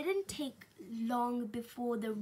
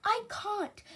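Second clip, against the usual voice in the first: protesting; 8 semitones higher than usual. A narrator's reading. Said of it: "started to say".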